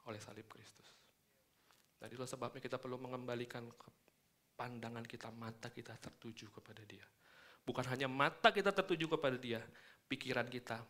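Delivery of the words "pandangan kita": ordinary delivery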